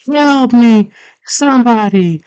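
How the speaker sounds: high-pitched imitated cry for help; hammed-up high panicked yell; mock screaming. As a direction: shriek like a cartoon damsel in danger. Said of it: "So he hears her screaming".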